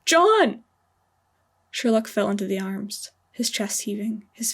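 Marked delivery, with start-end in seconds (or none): 0.1-0.6 much higher and louder cry of the name; despairing shout; anguished scream. Acted. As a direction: scream after the body being taken. Dragged away from his friend.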